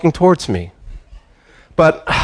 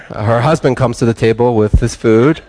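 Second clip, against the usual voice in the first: ordinary delivery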